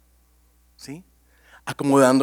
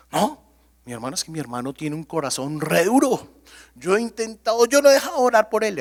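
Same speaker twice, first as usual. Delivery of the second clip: higher, louder and rushing; mock defensive protest; 5 semitones higher, 4 dB louder than usual. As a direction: defend yourself indignantly, fast. Defensive believer retort, acted.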